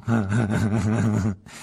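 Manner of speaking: drawn-out mocking laugh; sneering laugh. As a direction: laugh with contempt. Malfoy mocks Weasley family.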